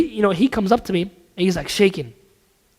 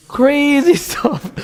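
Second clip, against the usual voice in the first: laughing; 7 semitones higher, much slower than usual. Real, not acted. Laughs at the idea.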